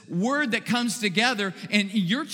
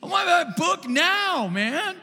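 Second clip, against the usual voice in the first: much higher, louder demanding whine; impatient customer; 12 semitones higher, 3 dB louder than usual. Retold or imitated. Pastor imitating impatient shoppers demanding a book, mock outrage. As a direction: demand it right now, outraged. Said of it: "people would be like".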